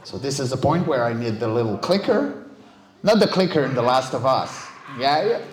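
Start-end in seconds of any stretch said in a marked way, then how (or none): none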